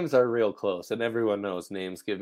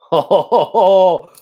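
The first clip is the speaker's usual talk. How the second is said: big high laugh out loud; loud burst of laughter; 9 semitones higher, 13 dB louder than usual. Real, not acted. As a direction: crack up loudly.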